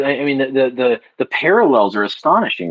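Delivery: usual speaking voice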